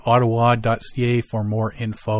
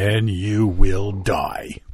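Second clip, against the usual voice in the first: mock doom; 4 semitones lower, much slower than usual. Mock doom safety warning.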